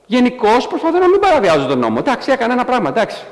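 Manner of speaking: high, wheedling excuse to a judge; pleading murderer. Pretend. Murderer shrugging off his crime before the judge, high and wheedling. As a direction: brush off the killing like a small slip. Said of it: "μιλάει στον δικαστή και λέει".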